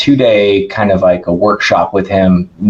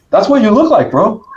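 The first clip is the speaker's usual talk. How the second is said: much higher imitated Brazilian accent; 13 semitones higher than usual. [name] delivering the punchline to the speaker in a mock Brazilian accent. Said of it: "he's got a thick Brazilian accent".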